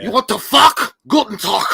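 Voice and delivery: high, loud mock German accent; exaggerated throaty German yelling; guttural German. Shown as a demonstration. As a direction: spit it out like an angry German. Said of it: "German is so guttural".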